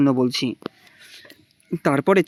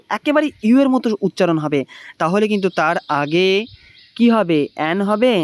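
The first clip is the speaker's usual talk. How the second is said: ordinary talk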